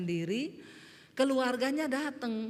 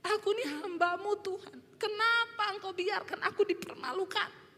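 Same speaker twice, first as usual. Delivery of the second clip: tearful prayer; 7 semitones higher than usual. Retold or imitated. Pastor crying alone to God in her room, pleading tearfully. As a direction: re-enact weeping before God, pitch climbing. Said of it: "saya nangis".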